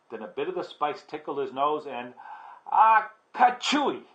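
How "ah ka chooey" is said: big, high storybook sneeze; slow build-up into a loud "achoo"; acted sneeze. A narrator's reading. Kitty boy with spice in his nose, sneezing loudly. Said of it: "The kitty boy sneezed".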